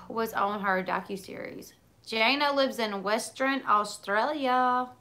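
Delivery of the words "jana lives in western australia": slow, half-hearted Australian accent attempt; bad Australian accent. Shown as a demonstration. Reading a viewer's comment aloud in a poor Australian accent. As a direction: try an Aussie accent and miss it. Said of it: "That was not a good accent".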